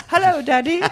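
put-on very high, prim little British kid; British-accented child. Kid answering dad's call, high British accent. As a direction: pipe up as a tiny British child. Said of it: "The kid developed a British accent".

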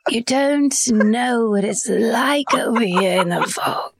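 put-on slow, slurring drunk Brit; hushed, woozy British accent. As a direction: play a tipsy British person. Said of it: "if it was a drunk British person".

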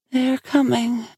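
high, distant oracle voice; far-away. A narrator's reading. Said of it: "sounding far away".

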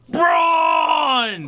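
long, high imitation bellow; Braun Strowman. Recalling the wrestler at the compound fight, mock scream. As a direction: scream your own name, stretched right out. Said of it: "He was just like".

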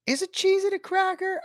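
very high, squeaky question; high-pitched self-doubt; puzzled questioning. Real, not acted. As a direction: pose it way up high, mock-pondering. Puzzles over his own claim again.

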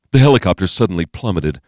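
plain reading voice

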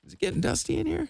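high, playful mock innocence; mock innocent. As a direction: pretend nothing is wrong, high and coy. Joking about crying on plane.

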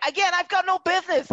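high-pitched, loud protest; exasperated rant. Real, not acted. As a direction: insist emphatically, voice pushed way up. Comic rant disowning the show's cast.

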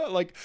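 high, breathless laughter through crying; laughing through tears. Real, not acted. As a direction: let the laugh burst out mid-cry. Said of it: "you're almost laughing and you're, like, kind of choking on your tears".

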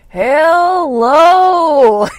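long, high, loud swooping hello; drawn-out phone hello. Retold or imitated. Imitating how the repairman answered her call, long high hello. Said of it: "That's how he answered the phone".

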